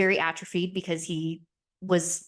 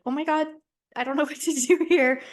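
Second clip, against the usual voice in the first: high, flustered, with a laugh; high, louder mock panic with a laugh; flustered boyfriend; 9 semitones higher, 5 dB louder than usual. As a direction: play the sweet boyfriend caught off guard, laughing nervously. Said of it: "was so sweet and was like".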